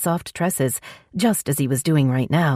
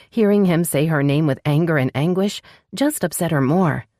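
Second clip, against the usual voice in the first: ordinary delivery, nothing marked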